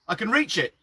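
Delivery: calling up from below. Acted. Mickey down the well shouting up to Phil, loud call. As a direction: yell it up from the bottom of the well.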